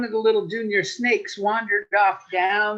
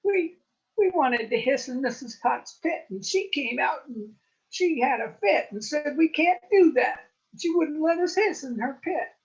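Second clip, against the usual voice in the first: whiny little snakes; 6 semitones higher, 3 dB louder than usual. Acted. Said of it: "they said".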